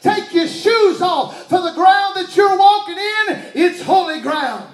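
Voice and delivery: God commanding Moses, booming. Acted. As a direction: boom it out like God from the bush. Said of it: "he tells Moses".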